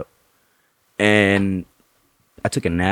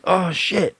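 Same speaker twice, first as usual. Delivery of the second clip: startled oh shit; 11 semitones higher than usual. Retold or imitated. Realizing he hurt nurse.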